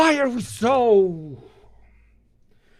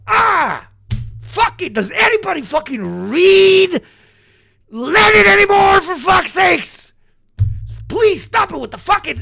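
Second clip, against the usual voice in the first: high, loud frustrated shouting; real angry outburst; 12 semitones higher, 5 dB louder than usual. Real, not acted. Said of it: "Sorry, it was too much".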